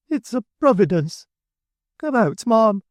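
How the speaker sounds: high, loud triumphant call; triumphant Mrs Sparsit. A narrator's reading. Mrs Sparsit calling into the coach, high and triumphant. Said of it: "then said Missus Sparsit".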